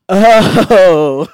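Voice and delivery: a loud, startled "Oh."; loud shocked gasp of understanding; sudden realization. Retold or imitated. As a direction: blurt it out as it dawns on you. Friend sees the stain.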